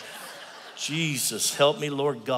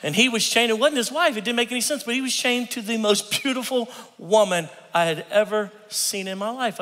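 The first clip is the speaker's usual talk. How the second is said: raised pitch, building amazement; storyteller's wide-eyed disbelief; amazed; 8 semitones higher than usual. Retold or imitated. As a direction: tell it like you can't believe your eyes. Amazed at beautiful woman, high pitch.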